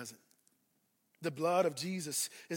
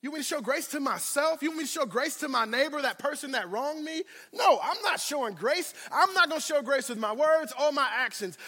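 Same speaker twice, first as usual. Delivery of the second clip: indignant refusal; 6 semitones higher, 3 dB louder than usual. Acted. Acting stubborn believer refusing grace.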